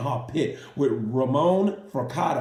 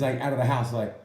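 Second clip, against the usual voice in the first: usual speaking voice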